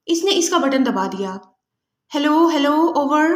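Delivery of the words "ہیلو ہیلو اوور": a lightly put-on Margaret voice; imitating Margaret. Julia fakes Margaret's voice on the transmitter, lightly put-on. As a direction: slip into the captive woman's voice on the radio. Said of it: "Margaret کا مخصوص لہجہ بناتے ہوئے".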